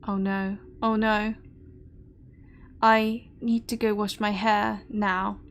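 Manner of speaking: ordinary talk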